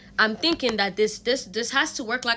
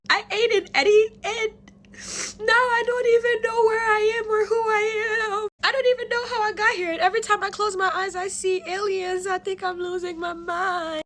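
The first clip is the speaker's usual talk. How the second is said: panicked crying; 11 semitones higher than usual. Retold or imitated. Telling her mentor she's lost, panicked and teary. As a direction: retell it half-sobbing, words tumbling fast. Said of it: "I am utterly just freaking out".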